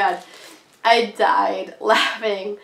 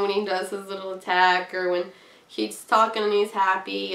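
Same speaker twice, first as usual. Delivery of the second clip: ordinary talk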